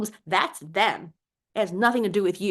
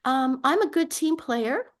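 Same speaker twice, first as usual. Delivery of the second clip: higher, slow, hesitant self-introduction; shy timid candidate; 6 semitones higher, slower than usual. Shown as a demonstration. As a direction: shrink yourself like a nervous applicant. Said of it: "see how the whole demeanor is different".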